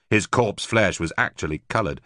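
plain reading voice

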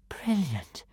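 breathy awed whisper. Acted. Girl whispering in awe at the flying boy. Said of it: "whispered Kamikaze".

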